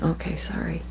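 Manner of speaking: soft unvoiced whisper. Real, not acted. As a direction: drop to a whisper. Whispers mid reading.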